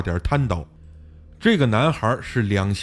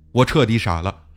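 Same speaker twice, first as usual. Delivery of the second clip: usual speaking voice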